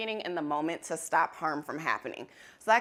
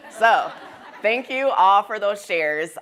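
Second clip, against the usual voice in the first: normal talking voice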